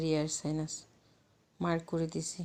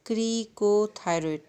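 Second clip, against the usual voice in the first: slow emphatic repeat; 3 semitones higher, 6 dB louder, slower than usual. Shown as a demonstration. Teacher repeating a new term for students, slow and emphatic. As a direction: stretch "ক্রিকোথাইরয়েড" out forcefully for learners.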